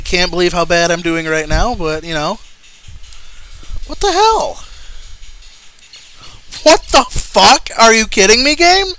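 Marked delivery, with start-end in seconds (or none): 3.9-4.6 high-pitched what the hell; yelped at a cheap hit; shocked outburst. Real, not acted. Frustrated gamer squeals at the boss fight. 6.6-8.9 angry outburst. Real, not acted. Gamer rages at the game after getting hit.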